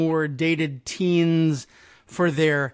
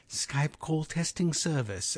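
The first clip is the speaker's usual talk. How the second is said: softer imitation of the English accent of the Skype test lady; 6 dB quieter, slower than usual. Host mimicking Skype's recorded test lady, English accent. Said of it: "as they like to say it".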